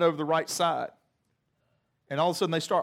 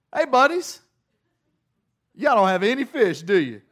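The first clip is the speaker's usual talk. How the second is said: Jesus yells from the shore to his buddies on the boat; yelled out; 2 semitones higher, 5 dB louder than usual. Retold or imitated. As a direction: holler across the water to the fishermen.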